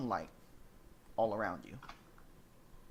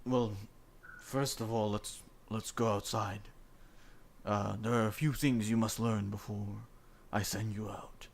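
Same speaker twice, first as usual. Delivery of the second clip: tiefling voice; 3 dB louder than usual. Acted. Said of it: "He's like".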